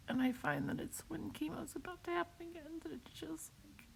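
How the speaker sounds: high, fading tearful voice; soft, rising pitch, choking up until words fail; breaking into tears. Real, not acted. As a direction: let the voice give out into crying. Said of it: "I don't know why I'm crying".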